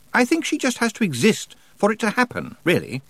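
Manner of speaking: high-pitched bookish witch; Miss Tick, explaining. Acted. Miss Tick explains the Summer Lady's job, tentative. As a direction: offer it high, then settle on the last word.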